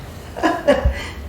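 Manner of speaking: high breathy laugh; real laugh. Real, not acted. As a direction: laugh at the picture. Reader laughs at picture.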